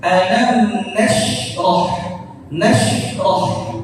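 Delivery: "Alam nashrah" said slowly with a clean shin; slow, careful "Alam nashrah"; correct tajwid. Shown as a demonstration. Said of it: "Ya, ya seperti itu".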